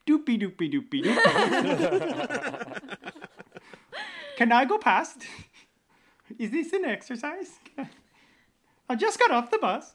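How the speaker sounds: high, loud mock Stavanger accent; sing-song Scandinavian caricature of a Stavanger local; Swedish Chef voice. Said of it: "Like the Swedish Chef".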